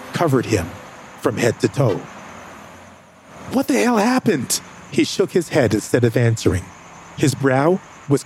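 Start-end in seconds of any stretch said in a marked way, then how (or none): 3.5-4.6 high, stunned demand; shocked question. Acted. Narrator asking James what happened, shocked. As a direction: ask in disbelief.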